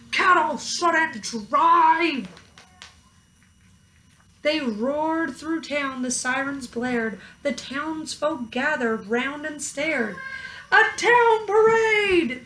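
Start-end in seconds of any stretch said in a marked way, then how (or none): 0.1-2.3 loud, slow fist-shaking protest; mayor fuming at the cows; angry mayor. A narrator's reading. Mayor shaking his fists at the cows, loud and slow. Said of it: "The mayor shook his fists and said". 10.7-12.4 high, loud delighted cry; townsfolk declaring. A narrator's reading. Townsfolk cheering the cows, high and delighted. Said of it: "they all declared".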